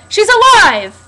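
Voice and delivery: high, loud mock shout of the cop; cop yelling. Fan retelling the cop's line with a loud mock shout. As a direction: call it out across the woods. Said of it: "He yells out".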